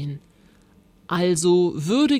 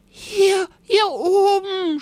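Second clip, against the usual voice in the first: high, loud, stretched-out call; screaming; 14 semitones higher, 6 dB louder, slower than usual. A narrator's reading. Rosa answering Paul from above, screaming high. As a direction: yell with all your might. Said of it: "schrie sie so laut".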